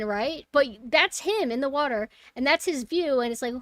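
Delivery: normal talking voice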